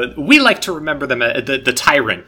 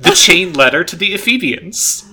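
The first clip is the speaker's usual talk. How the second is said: raised, preachy tyrant reading; sermon voice; 5 semitones higher, 5 dB louder than usual. Acted. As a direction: boom it out like a sermon. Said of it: "Oh, suddenly I hear a thousand sermons in the back of my head".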